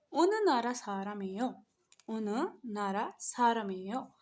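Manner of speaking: "उन नारा सारा में हो" said slowly and clearly; slow Korean model line. Shown as a demonstration. Teacher modelling the Korean line slowly for learners. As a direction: model the Korean phrase at half speed. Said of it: "Korean में कहते हैं".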